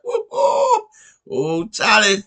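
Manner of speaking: high, loud whooping celebration with a laugh; cheering. Retold or imitated. Father celebrating his newborn, loud high whooping cheer. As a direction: whoop it up like a giddy new dad.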